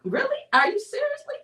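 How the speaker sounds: can't believe what she's hearing; shocked, outraged question; incredulous. Retold or imitated. Retelling disbelief at husband.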